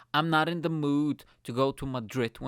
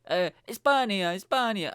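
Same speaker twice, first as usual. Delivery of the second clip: hammed-up Spanish voice, pitched up; imitated Spanish speakers; 8 semitones higher, 5 dB louder than usual. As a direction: play a clueless Spaniard answering proudly. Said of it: "Spanish people speaking Spanish".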